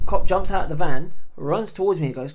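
normal talking voice

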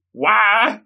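short high comic wail; mock scream. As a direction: give a high, half-serious cry of panic. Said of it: "he's screaming".

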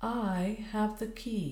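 slow, grave and deliberate; grave answer. A narrator's reading. Lawrence admits to Missus Clayton he holds the key, grave. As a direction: answer gravely, drawing the words out. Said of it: "he answered gravely".

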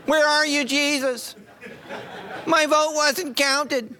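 hammed-up pouting to Jesus; mock whining voter. Pastor mocking churchgoers after the election, high mock whine. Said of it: "Sound like you?".

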